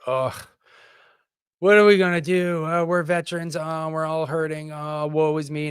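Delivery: mock self-pity. Veteran mocking the victim attitude he is fed up with, whiny self-pity. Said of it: "fed up with this attitude of like".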